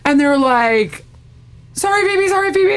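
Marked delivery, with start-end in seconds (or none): 1.7-2.8 high, hurried mock apology; panicky apology. Guys got hummus on Phoebe's dress, panicky apology. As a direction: blurt sorry in a small high voice.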